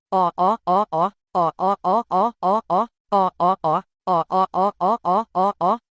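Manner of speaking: low rumbling beast growl in repeated bursts; creature growl. A narrator's reading. One-eyed dog beasts closing in on the party, low menacing growl. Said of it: "Com aquele rosnado arrepiante".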